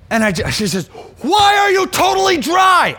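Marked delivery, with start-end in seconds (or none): 1.2-2.9 high, slow, full-force shout; loud, urgent scolding shout; re-enacted yell. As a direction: yell it in alarm, drawing the words out. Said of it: "And I yelled at him".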